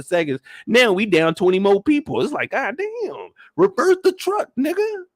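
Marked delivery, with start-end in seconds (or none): 2.5-5.0 panicked yell. Retold or imitated. To the driver as zombies close in, a high frantic yell.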